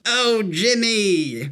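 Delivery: high, slow put-on comedian voice; Sarah Silverman impression. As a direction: drag out "Oh, Jimmy" in a character voice. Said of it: "my Sarah Silverman impression".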